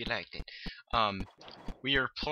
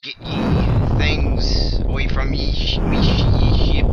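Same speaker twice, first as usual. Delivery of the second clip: loud, slow pirate bellow; 11 dB louder than usual. Pretend. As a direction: boom it out like a captain.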